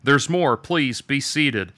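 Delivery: high, loud call to the audience; excited call. A narrator's reading. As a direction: call a cheering crowd to order. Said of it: "shouted Arthur excitedly over the din".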